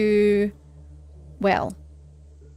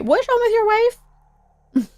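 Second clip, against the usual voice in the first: very high, drawn-out squeaky question; exaggerated, incredulous high-pitched imitation; mock nosy visitor; 13 semitones higher, 4 dB louder, much slower than usual. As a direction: shoot up high in mock prying. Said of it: "and then going".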